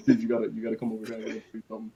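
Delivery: quick, hushed, urgent mutter. Retold or imitated. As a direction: rush it under your breath. Hushed urgent fake excuse.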